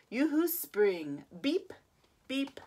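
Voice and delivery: usual speaking voice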